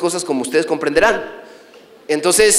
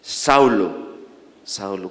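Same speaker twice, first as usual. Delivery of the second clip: low, falling call that fades to very soft; hushed, fading divine summons; voice from heaven; 12 semitones lower, 4 dB quieter, much slower than usual. Acted. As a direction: repeat the name low, letting it die away. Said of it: "dos veces su nombre".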